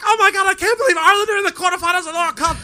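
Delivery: very high, loud mock elation; imitated ecstatic mother. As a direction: squeal it like an overjoyed mother. Mother's joy at win, retold.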